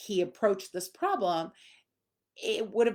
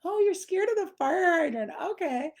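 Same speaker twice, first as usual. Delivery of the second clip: much higher, teasing; playful mock surprise; 12 semitones higher, 5 dB louder than usual. Shown as a demonstration. Trainer teasing a scared dog about a fire hydrant, playfully high. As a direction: make light of the dog's fear, jokey. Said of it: "make it funny".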